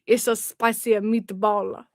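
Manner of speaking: slow comic Italian accent; botched Russian sliding into stock Italian; Italian-sounding Russian accent. Shown as a demonstration. Streamer's Russian attempt turning Italian, slow hammy accent. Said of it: "This would be the Italian suburb of Moscow".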